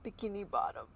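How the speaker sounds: Bikini bottom in a lightly put-on goofy accent. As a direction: say "Bikini bottom" with a silly, slightly put-on twang. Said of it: "You didn't have to say it in that accent".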